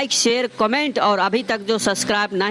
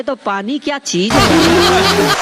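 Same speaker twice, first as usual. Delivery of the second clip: higher, louder and slow through a laugh; laughing punchline; 5 semitones higher, 6 dB louder, much slower than usual. Acted. Girlfriend mocking the boyfriend's singing, laughing it out.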